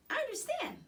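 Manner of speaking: high squeaky little voice; hamster squeak. A narrator's reading. Hamster answers the girl in a high squeak. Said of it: "I squeaked".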